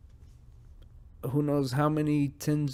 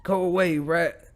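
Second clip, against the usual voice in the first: higher, louder shoo; shooing shout; 5 semitones higher, 6 dB louder than usual. Real, not acted. Podcaster fending off a rat, louder and higher. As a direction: yell it to drive the rat away. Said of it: "That's me fending off a fucking rat".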